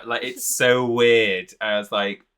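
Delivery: ordinary talk, nothing marked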